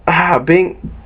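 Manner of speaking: normal talking voice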